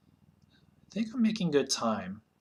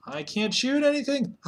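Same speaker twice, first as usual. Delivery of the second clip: frustrated cry; 11 semitones higher than usual. Real, not acted. Missing every shot.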